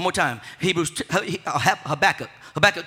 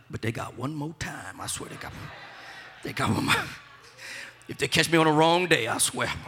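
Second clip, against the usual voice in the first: much softer, lower, half-whispered threat; muttered grudge; 6 semitones lower, 7 dB quieter than usual. Retold or imitated. Preacher voicing a believer's grudge, muttered softly. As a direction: mutter it under your breath. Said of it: "you're like".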